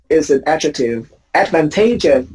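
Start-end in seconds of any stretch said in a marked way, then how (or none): 1.3-2.3 Advantageous stressed on TA, ad-van-TAY-jus; teacher's careful ad-van-TAY-jus; shifted stress. Shown as a demonstration.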